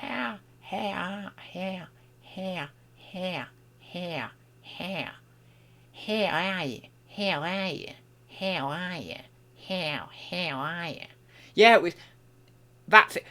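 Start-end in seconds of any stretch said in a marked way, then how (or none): 0.0-11.1 quiet, higher practice run at an Australian accent; tentative Australian vowels, repeated softly; attempted Australian accent. Shown as a demonstration. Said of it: "I tried to do an Australian accent".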